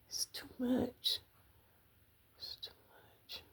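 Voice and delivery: soft whisper, fading to almost nothing; holding back tears. Retold or imitated. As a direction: whisper the plea, trying not to cry. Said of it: "Trying not to cry".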